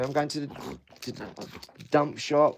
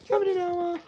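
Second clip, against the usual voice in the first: very high squeal to the dog; squeaky puppy call; 3 dB louder than usual. Real, not acted. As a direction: call the pup in a tiny high voice.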